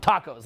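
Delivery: "tacos" with the American broad AH. Shown as a demonstration. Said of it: "like how you guys say it".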